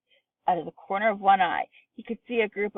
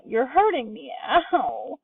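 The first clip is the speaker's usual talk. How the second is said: high pitched, with a yelp of pain; pained cry; 6 semitones higher, slower than usual. A narrator's reading. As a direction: cry out as the arm is twisted. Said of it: "cried Grandma Georgina".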